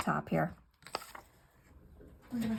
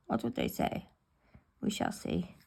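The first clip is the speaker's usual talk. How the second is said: whispered aside, lower; skeptical whisper; 13 semitones lower, 4 dB quieter than usual. Real, not acted. Crafter doubting vellum glue tip to viewers, whispered.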